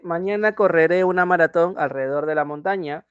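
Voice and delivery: lee la oración alto y claro; frase de ejemplo leída para la clase; lectura de oración. Shown as a demonstration. (lectura de ejemplo en voz alta)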